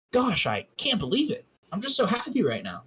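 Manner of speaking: happy exclaiming. A narrator's reading. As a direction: gush it out, giddy. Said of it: "she exclaimed".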